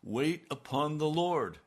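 pressing the divine command harder; insistent command. Retold or imitated. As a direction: repeat the command with force this time. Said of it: "But he said".

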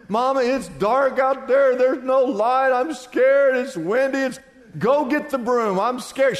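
put-on a little boy afraid of the dark, begging; scared little boy, pleading. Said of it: "he was scared to death".

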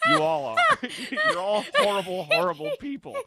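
very high, laughing accusation; laughing exasperation. Real, not acted. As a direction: scold them while cracking up. Laughing outburst at teasing co-hosts.

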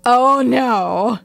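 a loud retold cry of horror; horrified shout. Viewer retelling her horrified reaction to the scene, loud and shocked. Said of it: "I went".

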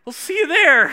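put-on a teen faking macho confidence; mock tough bravado. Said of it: "with all of the testosterone I could muster".

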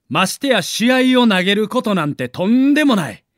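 ordinary delivery

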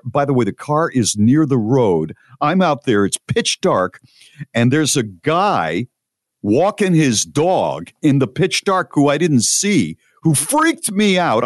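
ordinary talk, nothing marked

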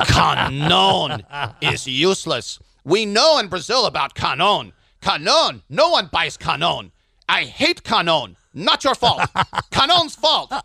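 put-on high Brazilian accent, stretched Canon. Rivellino consoling the volunteer about Canon, Brazilian accent. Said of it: "he said".

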